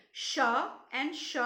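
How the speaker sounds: "sh" sounded out with a short uh. Shown as a demonstration. Teacher showing the repeated sound in an alliteration, SH sound. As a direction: say "sh" as a lone phonic, sh-uh. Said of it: "sh sound is repeated".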